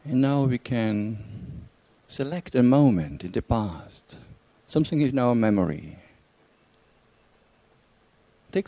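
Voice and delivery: calm, unhurried meditation guidance with long pauses; guided meditation. Real, not acted. Teacher guides listeners back through a memory, calm meditation voice. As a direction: lead them inward gently, leaving silence between phrases.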